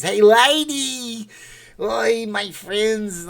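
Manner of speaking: high, slow comic holler; stretched-out goofy comedian's cry; Jerry Lewis. Acted. Streamer offering viewers an impression, high Jerry Lewis voice. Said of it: "do a Jerry Lewis impression".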